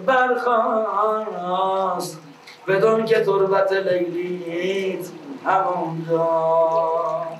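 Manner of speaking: high, slow chanted verse recital; epic teahouse storyteller. Acted. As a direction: intone the verse slowly like a bard.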